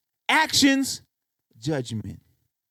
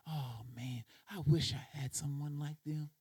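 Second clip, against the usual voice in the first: much softer, lower, longing murmur; wistful sigh; 6 semitones lower, 14 dB quieter than usual. Acted. Lonely listener hearing a love song, soft and wistful. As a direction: breathe it out like a lonely heartsick sigh.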